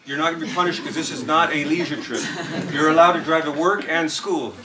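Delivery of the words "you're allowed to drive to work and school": ordinary talk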